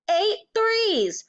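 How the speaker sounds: high, hammered-out shout; bullying headmistress calling out a sum. A narrator's reading. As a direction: drum it into a pupil angrily. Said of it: "the Trunchbull shouted".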